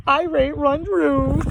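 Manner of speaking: dog voice with R sounds; sheepish cartoon dog; Scooby-Doo voice.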